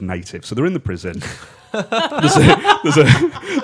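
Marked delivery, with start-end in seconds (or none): 1.7-3.6 high, helpless laughter breaking up the words; squeaky with laughter, stalling; laughing. Real, not acted. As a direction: try to start the sentence through real giggles. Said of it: "I'm just laughing at that".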